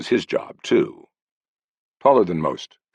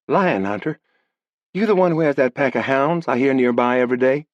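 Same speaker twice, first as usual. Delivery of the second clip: put-on higher girl asking; curious young woman; girl questioning the lion hunter; 9 semitones higher than usual.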